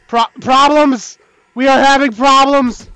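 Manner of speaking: loud, high, drawn-out distress call; alarmed shout. Real, not acted. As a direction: announce disaster at a yell. Tower failing.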